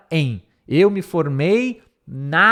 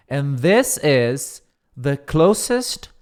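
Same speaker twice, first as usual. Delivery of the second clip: slow read; much slower than usual. Shown as a demonstration. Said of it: "teacher reads the quote".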